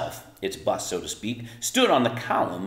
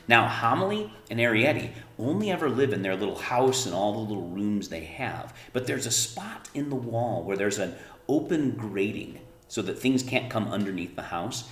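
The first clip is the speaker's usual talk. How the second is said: ordinary delivery, nothing marked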